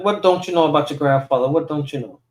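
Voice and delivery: ordinary delivery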